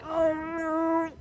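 high dismayed groan of the whole van; mock dread. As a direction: voice the group's sinking dread. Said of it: "we're all going".

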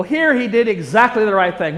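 ordinary delivery

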